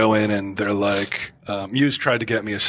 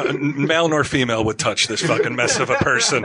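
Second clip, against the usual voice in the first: usual speaking voice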